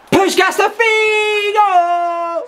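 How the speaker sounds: drawn-out, high commentator yell; long, high shout as the chance opens; excited shout. Real, not acted. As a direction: bellow it like a goal call. Goal chance, commentating own play.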